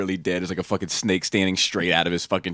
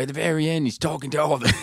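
put-on high, hammy Australian accent, breaking into laughs; a Crocodile Dundee style Australian accent; bad Australian accent; 9 semitones higher than usual. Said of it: "I do a horrible Australian accent".

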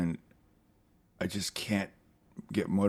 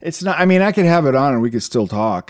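protesting; 7 semitones higher, 9 dB louder than usual. Real, not acted. Defending against stupid game jab.

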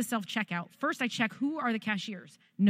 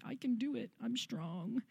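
put-on toddler voice; 11 dB quieter than usual. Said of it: "video of Jairus when he's two".

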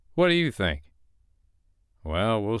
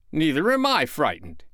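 defiant retort; 7 semitones higher than usual. A narrator's reading. Father denies fear back.

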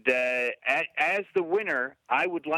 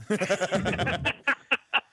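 laughing; 4 semitones higher than usual. Real, not acted. Reacting to being dismissed.

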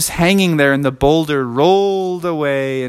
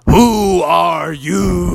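knight challenge; 6 semitones higher, much slower than usual. Acted. Knight challenges them, sword drawn.

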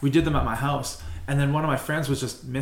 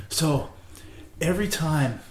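stoned slow drawl; much slower than usual. Retold or imitated. Stoned friend explains the plunger.

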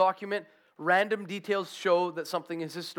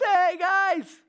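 high, loud imitated hail from shore; calling out; 11 semitones higher, 6 dB louder than usual. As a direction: holler to friends across the water. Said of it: "he calls out to them".

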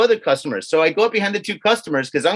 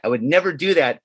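ordinary delivery

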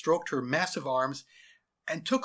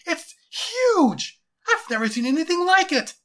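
very high, loud astonishment; awestruck soldier; 11 dB louder than usual. Acted. Soldier marvelling at the creature to his general, awestruck. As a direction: cry it out, stretching the big words. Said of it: "said an awestruck soldier".

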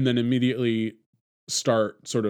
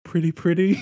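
1 pretty pretty; 5 semitones higher, 3 dB louder than usual. Retold or imitated. Quoting Dawn after hospital unwrapping.

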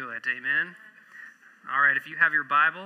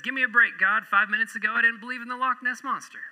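a lightly voiced exasperated atheist, higher; mock indignant comeback, pitched up and quick at the end; atheist's retort; 8 semitones higher, 4 dB louder, faster than usual. Atheist argues back to God mid-air, mock exasperated. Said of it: "The atheist says".

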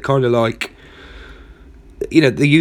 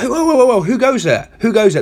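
higher, alarmed challenge; startled enemy; 8 semitones higher than usual. Acted. Enemy soldier challenges the laughing battalion, startled.

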